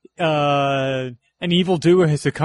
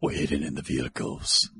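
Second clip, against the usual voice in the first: slow, hushed movie-style whisper; low, soft dramatic whisper; mock-dramatic whisper; 12 semitones lower, 7 dB quieter, slower than usual. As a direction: whisper it reverently, drawing out the words. Quoting Mad Max style film.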